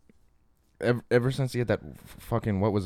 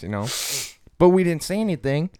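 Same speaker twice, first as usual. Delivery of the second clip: ordinary talk, nothing marked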